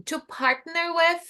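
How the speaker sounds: slow model of to partner with. Shown as a demonstration.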